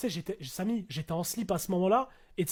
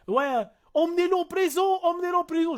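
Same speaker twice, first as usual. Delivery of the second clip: imitated shout; 7 semitones higher than usual. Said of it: "qui crie en même temps".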